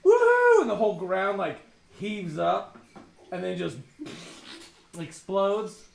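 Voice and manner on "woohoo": wild high whoop; gleeful whoop. Retold or imitated. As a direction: shout it with manic joy. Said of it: "sitting there going".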